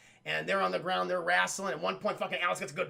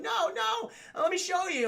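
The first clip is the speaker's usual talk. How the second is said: high voice; 11 semitones higher than usual. Retold or imitated. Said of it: "She's like".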